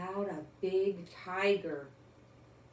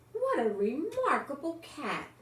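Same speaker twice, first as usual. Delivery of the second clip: higher, admiring policeman voice; impressed policeman; 6 semitones higher than usual. A narrator's reading. Policeman praising Mog, voiced higher in admiration. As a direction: marvel at the cat, higher. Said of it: "and he said".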